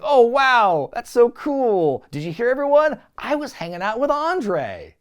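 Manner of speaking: high, loud hammed-up awe; exaggerated, showy wow; mock boastful amazement. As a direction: fake being thrilled by your own name drop. Mocking Rock's wait for crowd reaction.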